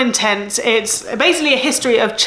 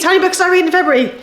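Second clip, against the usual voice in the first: higher, fast, game-show suspense on "many"; drum roll build-up; 7 semitones higher, much faster than usual. Shown as a demonstration. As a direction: hype it up like a quiz host announcing the result. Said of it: "Drum roll, please".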